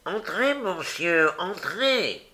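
high, slow nasal welcome; drawn-out, high adenoidal voice; nasal servant. A narrator's reading. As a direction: usher a guest in with a nasal whine. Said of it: "affligé d'une voix nasillarde".

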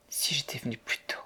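soft, whispered regret; faltering murmur. A narrator's reading. Mary learns the ship has left, stammering and hushed. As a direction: let it out faintly, barely voiced. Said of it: "balbutia Mary".

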